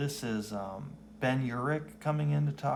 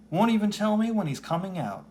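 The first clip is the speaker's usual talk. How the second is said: indignant complaint; 10 semitones higher, 8 dB louder than usual. Acted. Heather complains about Matt.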